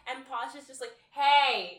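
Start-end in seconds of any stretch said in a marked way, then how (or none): 1.1-1.8 abrupt, high, loud call; mock shouting to rouse someone; wake-up shout. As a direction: imitate yelling at a sleeper. Acts Posh waking a coma patient.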